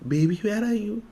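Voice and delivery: mother faking going far away, fading. Acted. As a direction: let the voice drift away. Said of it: "she reduces the volume to as though she's gone far away".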